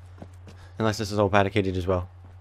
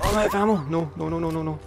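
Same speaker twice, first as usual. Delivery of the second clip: panicked; 7 semitones higher than usual. Real, not acted. Out of ammo, enemies charging.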